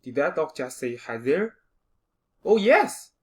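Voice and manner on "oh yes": excited. Acted. Excited dog.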